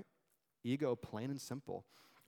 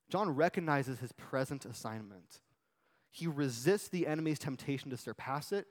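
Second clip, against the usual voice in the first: usual speaking voice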